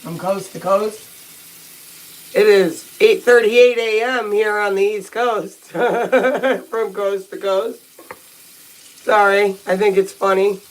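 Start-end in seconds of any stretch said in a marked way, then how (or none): 0.0-7.8 talking with a toothbrush in mouth; slow, muffled words mid-brush; while brushing teeth. Real, not acted. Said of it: "while I'm brushing my teeth".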